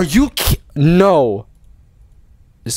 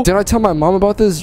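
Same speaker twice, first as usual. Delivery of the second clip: ordinary talk, nothing marked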